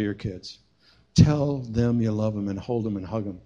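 normal talking voice

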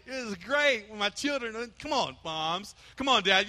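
normal talking voice

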